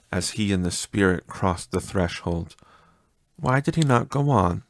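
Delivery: plain reading voice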